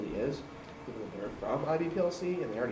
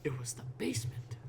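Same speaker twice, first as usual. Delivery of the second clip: whispered eerie reveal; spooky whisper; 3 semitones higher, 6 dB quieter than usual. Pretend. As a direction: hush it like a ghost story.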